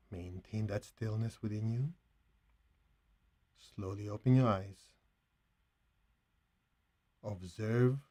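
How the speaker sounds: soft, unhurried phrases with long pauses; guided meditation. Acted. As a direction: gently guide them back from stillness, barely above a hush.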